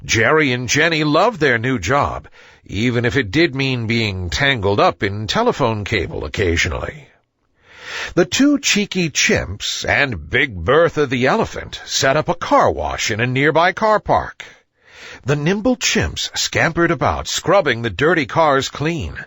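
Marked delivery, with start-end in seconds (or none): none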